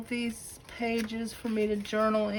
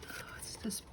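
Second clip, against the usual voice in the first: awed whisper; 14 dB quieter than usual. Real, not acted. Admiring a handmade journal.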